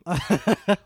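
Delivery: a real burst of laughter, rising; laughing. Real, not acted. Podcast guest laughing at the host's question, real laugh. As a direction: laugh out loud, genuinely amused.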